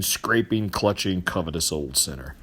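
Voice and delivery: plain reading voice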